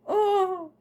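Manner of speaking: high, drawn-out cooing oh; long, high melted "oh"; touched "aww". Real, not acted. Touched by old love card.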